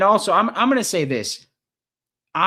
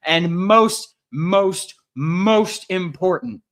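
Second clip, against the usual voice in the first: most, most, most important, each "most" louder and higher, the last one slow; emphatic build-up; 6 semitones higher, 7 dB louder, much slower than usual. Real, not acted. Host building hype before announcing the signing, emphatic.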